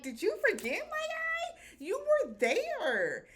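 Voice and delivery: squeaky high mock voice, slowing at the end; mocking divers. Divers mocking the suspicious cop, squeaky high sneer. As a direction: sneer it up high like you can't believe him. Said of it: "And they like".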